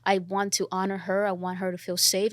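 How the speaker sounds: ordinary talk, nothing marked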